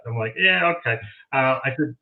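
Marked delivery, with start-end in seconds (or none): 0.4-1.0 high, doubtful mock agreement; skeptical. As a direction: retell a sceptical yeah-okay. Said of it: "very skeptical of that".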